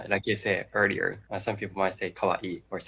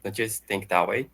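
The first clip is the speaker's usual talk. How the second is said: ordinary talk, nothing marked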